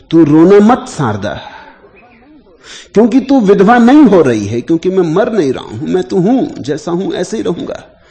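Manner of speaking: loud, high-pitched insistence, easing at the end; retold deathbed promise, declared forcefully; emphatic reassurance. Dying husband telling his wife she will not be widowed, emphatic.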